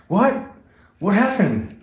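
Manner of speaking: mock panicked question, jumping high; panicking. Partner learns of a race challenge, mock panicking.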